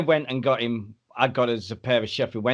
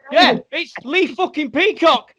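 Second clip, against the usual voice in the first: loud, high, drawn-out mock squeal; imitating an overexcited fanboy; starstruck fan; 7 dB louder, slower than usual. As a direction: squeal it out, overawed.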